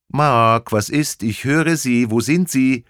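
high, loud, urgent female voice; worried woman calling. Acted. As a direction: call out anxiously for Mark. Woman calling Mark on phone.

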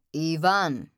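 loud reproving call of a name. A narrator's reading. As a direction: call the name firmly to rein someone in. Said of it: "rief der Dunkle".

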